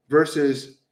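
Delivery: normal talking voice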